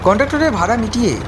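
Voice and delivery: usual speaking voice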